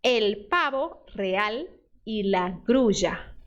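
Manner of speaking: slow, careful Spanish read for repeating; slow Spanish teacher read-aloud; slow model reading. Shown as a demonstration. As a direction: read it out slowly for students to copy. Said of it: "você vai pronunciar depois de mim".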